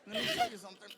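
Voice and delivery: high, loud, laughing excitement; excited outburst. Retold or imitated. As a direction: burst out with joy. Excited reply to Emily.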